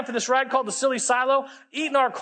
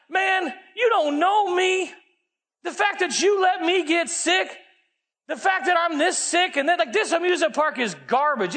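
mock angry rant; 6 semitones higher than usual. Angry rant at the park announcer.

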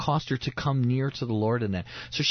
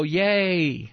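hammed-up, higher-pitched little yay; mock cheer; 5 semitones higher, 4 dB louder than usual. Preacher mocking a simplistic believer, exaggerated cheer. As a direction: throw out a drawn-out, shallow cheer. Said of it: "not to simply go".

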